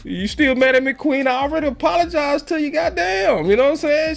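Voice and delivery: high-pitched whine. Real, not acted. Host whining high at Queen for fussing at him. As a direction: whine up high at an angry fan. Host answering an angry chatter.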